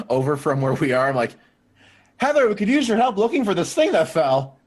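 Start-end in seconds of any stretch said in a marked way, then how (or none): 2.2-4.6 louder, higher call across. Acted. [name] calling Heather across the garage, raised. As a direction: call her over with a pretext.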